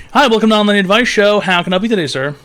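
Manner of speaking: bright, high show-host welcome; cheery host greeting. Acted. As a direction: greet the caller like a chirpy advice-show host.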